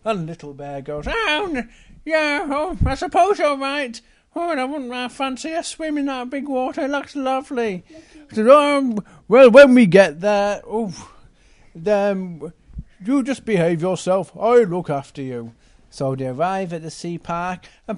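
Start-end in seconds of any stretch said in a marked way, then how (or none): 1.1-7.8 put-on high, childlike little bear voice. 8.5-15.5 a hint of a fatherly bear, louder; lightly voiced big bear, telling off gently.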